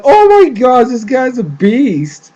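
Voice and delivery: high, loud fangirl outburst; amazed reaction. Retold or imitated. Viewer reacting to Tenzin's stern order, amazed outburst. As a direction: exclaim it high and loud, stretching the end. Own excited reaction to the scene.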